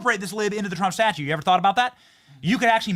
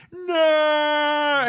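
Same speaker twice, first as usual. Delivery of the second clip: a sudden high, loud fake wail of "No!"; mock scream; 10 semitones higher, 5 dB louder than usual. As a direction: play the screaming statue crying out "No!". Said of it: "it'll scream".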